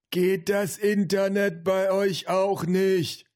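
slow, high-pitched grandpa call. A narrator's reading. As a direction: call from another room, every word drawn out. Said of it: "rief der Opa". (rufender Opa, hoch und gedehnt)

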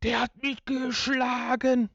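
high, slow, whiny complaint; mock wail of a wronged kid; whiny mock victim. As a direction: overplay the hurt child. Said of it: "Und nicht so".

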